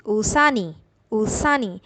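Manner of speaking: ऊसानी run together fast, as one smooth word; quick, joined. Shown as a demonstration. Teacher showing students the fast, linked ऊसानी. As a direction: blend ऊसान and ई quickly into ऊसानी. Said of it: "speed में बोलेंगे थोड़ी तेज़ी से".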